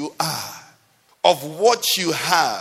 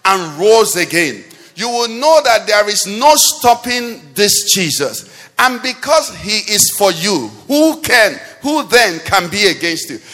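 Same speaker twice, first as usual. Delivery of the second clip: louder, building preaching cry; rising sermon; 6 dB louder than usual. Acted. Preacher to the congregation, swelling louder and louder. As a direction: build the sermon to a ringing peak.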